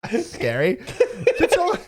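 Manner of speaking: shrieking with laughter, high and loud; laughing. Real, not acted. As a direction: laugh through it, real disbelief. Reacting to a surprise sound clip.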